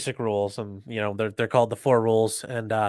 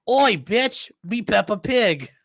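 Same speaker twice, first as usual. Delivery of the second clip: loud, high mock British accent; high British kid's demand, slowing on the last words; 10 semitones higher, 5 dB louder, much slower than usual. Kid walking in on the parents, cheeky mock British accent. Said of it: "it'd be funny if your kid walks in".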